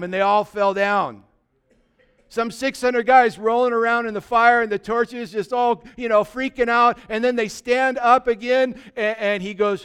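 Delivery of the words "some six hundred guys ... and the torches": ordinary talk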